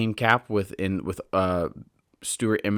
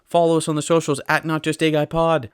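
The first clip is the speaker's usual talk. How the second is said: frantic; 6 semitones higher than usual. Real, not acted. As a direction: rattle off the plugs in a sudden burst of energy. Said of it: "I don't know why I'm so frantic all of a sudden".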